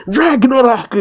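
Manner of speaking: high-pitched mock battle cry; attack cry. Acting out villain's attack call.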